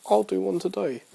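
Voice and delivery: high-pitched mock-eager resolve; acted eager decision. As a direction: act out a sudden bright idea in a high voice. Acts out own decision.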